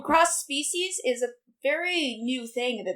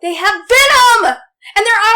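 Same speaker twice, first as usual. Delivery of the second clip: emphatic shouting; 8 semitones higher, 12 dB louder than usual. Real, not acted. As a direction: shout your winning argument, stretching it out. Heated mock debate over hybrids.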